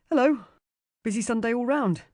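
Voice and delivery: cheerful, smiling greeting. A narrator's reading. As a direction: say it with a big smile. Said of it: "he smiles".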